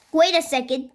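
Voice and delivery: ordinary delivery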